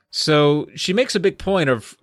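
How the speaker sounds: normal talking voice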